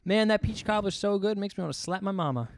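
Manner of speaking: put-on lightly Southern-accented exclamation. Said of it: "I think it's a Southern thing".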